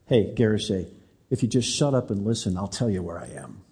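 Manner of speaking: low, soft, fading to nearly nothing; a hushed voice in his ear; still small voice. Retold or imitated. God whispering to the preacher at night, soft and low. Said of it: "this still small voice whisper in my ear".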